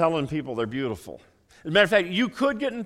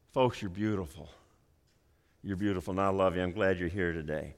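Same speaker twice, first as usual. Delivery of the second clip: much lower and softer, gently loving; hushed heartfelt reassurance; soft, tender affirmation; 10 semitones lower, 8 dB quieter than usual. Real, not acted. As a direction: speak it softly to each face. Softly affirming congregation after sermon point.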